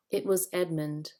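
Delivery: usual speaking voice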